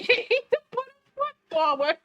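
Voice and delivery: real tears of laughter spilling into the words; crying with laughter. Real, not acted. Player apologising to her co-host, laugh-crying. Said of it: "I'm crying".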